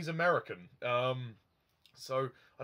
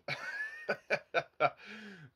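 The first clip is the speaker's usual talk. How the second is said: laugh; 7 semitones higher than usual. Real, not acted. Laughing at own joke.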